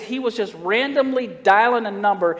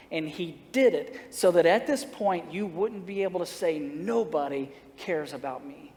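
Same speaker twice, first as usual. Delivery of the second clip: tender plea; 3 semitones lower, 6 dB quieter than usual. Retold or imitated. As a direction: speak gently to a kid in crisis. Pastor pleading gently with suicidal teen.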